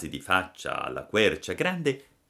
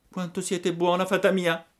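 lightly raised, grateful puppet voice; a hint of a touched boy; 12 semitones higher, 4 dB louder than usual. Pinocchio thanks the fairy. (burattino riconoscente, voce appena alzata)

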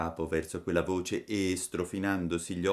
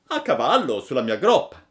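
loud, emphatic, raised character voice; pigeon making an offer; 7 semitones higher, 10 dB louder than usual. A narrator's reading. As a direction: call it out as the big pigeon. Pigeon offers his back.

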